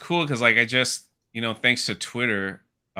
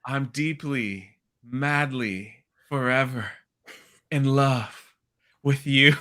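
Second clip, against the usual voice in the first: slow, halting, softly intoned lines; mock love declaration; 4 dB quieter, slower than usual. Fan mimicking Padme declaring love before dying, slow and halting. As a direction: draw out each word with pauses, mock-romantic.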